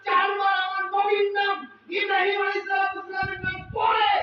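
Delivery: loud, high sung-out preacher's cry; full-force sermon climax; fiery preaching. Acted. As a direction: rise to a roaring climax for the crowd.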